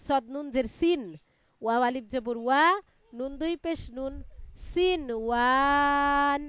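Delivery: measured recitation with long held syllables; Quran spelling drill. Shown as a demonstration. Teacher leading a student through Quran spelling, measured drawn-out recitation. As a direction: spell out each piece, then draw the joined word out.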